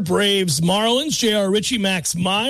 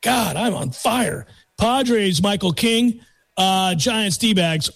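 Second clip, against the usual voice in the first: ordinary delivery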